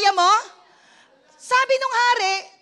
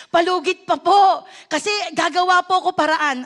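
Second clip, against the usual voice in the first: mock debtor pleading; 5 dB louder than usual. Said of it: "Sino po sa inyo dito sometimes humihingi ng palugit?".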